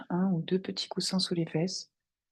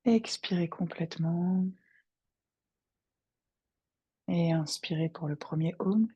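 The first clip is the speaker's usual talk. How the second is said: guided meditation. Acted. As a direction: guide them gently into their breath. Guide une méditation. (voix de méditation guidée, calme et posée)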